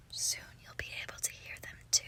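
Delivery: whispered close, barely voiced; hushed, very soft whisper; intimate whisper. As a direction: whisper it like a secret promise. Intimate secret to the listener.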